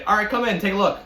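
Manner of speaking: high, loud retold welcome; inviting call. Calls prospective tenant inside.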